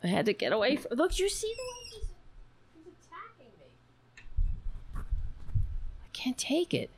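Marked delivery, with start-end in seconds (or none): 1.0-5.0 mock distress. Cat pesters her on stream. 6.0-6.9 hushed, breathy whisper of mock despair. Host whispering to viewers while her cat attacks.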